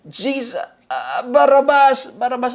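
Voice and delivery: mock sudden backtracking. Someone afraid of the crowd switches sides, mock panicked correction. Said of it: "biglang bumalimbing because of the fear".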